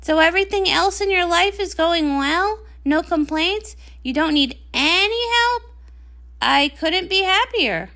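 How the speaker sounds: ordinary talk, nothing marked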